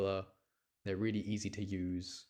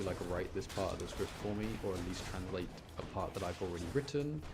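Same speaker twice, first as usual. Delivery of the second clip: ordinary delivery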